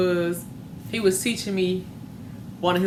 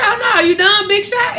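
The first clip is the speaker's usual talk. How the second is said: high-pitched, louder needling; mocking taunt; 11 semitones higher, 6 dB louder than usual. Dad taunting child who pulled hand back, mocking. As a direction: tease the quitter in a high, raised voice.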